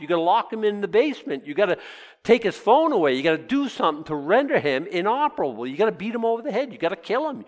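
high, rising preacher's build; fiery sermon climax; preaching. Acted. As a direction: drive it home from the pulpit. Preaching the strong man passage.

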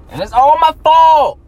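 loud, high cry of self-blame; exasperated outburst. Real, not acted. Trader admitting his losses to a friend, real exasperated shout. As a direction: burst out, voice shooting up.

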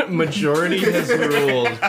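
laughing while announcing. Real, not acted. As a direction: declare it through laughter. Laughing group vote announcement.

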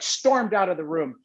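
usual speaking voice

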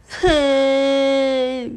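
a loud high wail starting a sob; 哇的一声哭出来; child bursting into a cry. Acted. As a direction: burst into tears. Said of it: "哇的一声就哭了".

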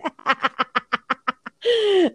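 long, high-pitched cackling laugh; real laughter trailing on gleefully; unapologetic laugh. Real, not acted. Speaker following "you love it" with proud, unapologetic laughing. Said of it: "I don't care if I laugh".

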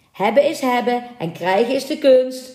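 a mild gloating yell; mocking shout. A narrator's reading. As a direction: call it out tauntingly. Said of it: "schreeuwde een derde".

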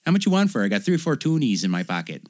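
high, folksy mock Canadian; Canadian accent. Canadian man haggling for a pimp's coat, mock accent. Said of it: "A pimp was wearing this coat and he says".

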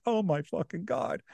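softly shocked, hushed; shocked whisper. Retold or imitated. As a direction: gasp it out in quiet shock. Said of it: "Jared's shocked".

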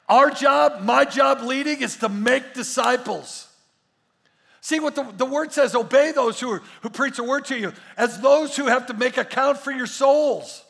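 loud, driving sermon; full-force preaching. Acted. Pastor exhorting the church loudly about their souls. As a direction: pound it home to the congregation.

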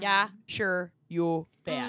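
put-on broad Minnesota accent, loud and hammy; Minnesotan accent. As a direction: say it like a jokey Upper Midwest local. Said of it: "unleash their worst Minnesotan accents".

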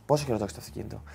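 low, soft imitated policeman; quiet questioning. Officer asks the teller about the car, low quiet voice. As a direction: ask it softly and low, like the good cop. Retold calm good cop questioning.

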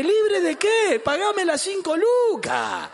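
high, hammed-up angry demand; indignant shout. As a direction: shout back like a cheated lender. Angry lender answers the singing pastor. (grito indignado y exagerado)

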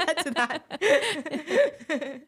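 high, drawn-out words through laughter; laughing. Real, not acted. Guest echoing the host about her circus past, laughing hard. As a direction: crack up while stretching out the words. Laughing echo of host's remark.

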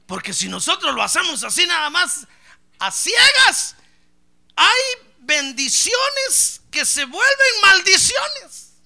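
fiery preaching. Real, not acted. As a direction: drive the warning home at full force. Preaching at full force.

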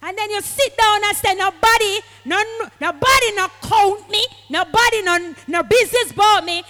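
full-force preaching. Acted. Preacher mocking the stubborn believer, loud preaching. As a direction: shout it over the congregation.

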